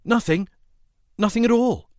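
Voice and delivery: high, loud feigned innocence; mock puzzlement. A narrator's reading. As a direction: overplay being puzzled, pitch shooting up. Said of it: "in mock puzzlement".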